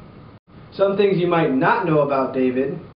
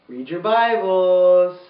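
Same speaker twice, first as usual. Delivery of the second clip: slow, drawn-out closing line; slow sign-off; 3 semitones higher, much slower than usual. Shown as a demonstration. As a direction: stretch each word out. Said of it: "sign-off".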